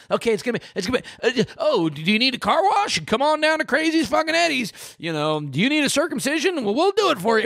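high, hammed-up radio ad pitch; ad announcer. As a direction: sell it like a cheesy local commercial. Mimicking radio commercials.

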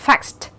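faxed ending in ks plus t. Shown as a demonstration. Teacher demonstrates the ED rule, faxed ending on t. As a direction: finish faxed with a voiceless t. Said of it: "E D đó sẽ được phát âm là âm".

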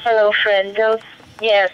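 normal talking voice

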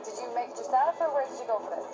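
ordinary delivery

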